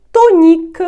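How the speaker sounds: тонік high and loud, with a plain N; no nasalisation. Shown as a demonstration. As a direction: punch out тонік with an oral O and clear N. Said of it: "носової вимови також не буде".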